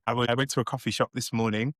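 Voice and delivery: ordinary delivery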